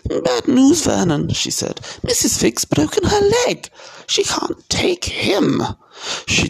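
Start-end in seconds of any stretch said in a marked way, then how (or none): 0.1-1.3 high, fretful woman's voice; anxious, high-pitched aunt character; worried aunt. A narrator's reading. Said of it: "looking both angry and worried". 2.0-5.6 very high, vexed woman's voice; high-pitched dismay, drawn out at the end; fretful aunt in a high falsetto; worried aunt. A narrator's reading. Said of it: "looking both angry and worried".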